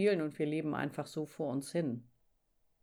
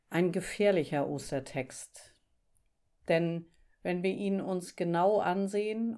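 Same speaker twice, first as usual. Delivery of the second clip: usual speaking voice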